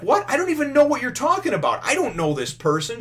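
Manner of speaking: high, indignant retort; mock bickering. Sergeant denies knowing his childhood friend, mock bickering. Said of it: "they're bitching at each other".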